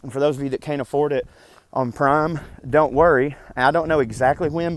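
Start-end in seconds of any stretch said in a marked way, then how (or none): none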